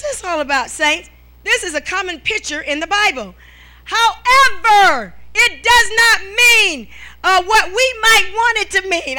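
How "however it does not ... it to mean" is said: slow, higher and louder preaching; emphatic preaching. Acted. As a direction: hammer the point home from the pulpit. Stressing a sermon point.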